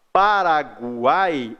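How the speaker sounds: "Paraguai" slow, with the U clearly heard as /gwa/; Paraguai, U sounded. Shown as a demonstration. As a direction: stretch "Paraguai" so the U is audible. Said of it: "eu tenho G e um U". ("Paraguai" bem devagar, com o U pronunciado)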